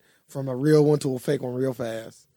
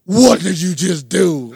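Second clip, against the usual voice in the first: angry; 3 semitones higher, 9 dB louder, much slower than usual. Retold or imitated. Friend suddenly angry over sandwich.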